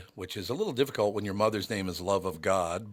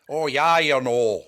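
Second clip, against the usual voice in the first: loud, higher mock Minnesota accent; 5 semitones higher, 9 dB louder, slower than usual. Mimicking people outside North Minneapolis, mock Minnesota accent. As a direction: exaggerate a rural Minnesota accent. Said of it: "could not understand what most people were saying".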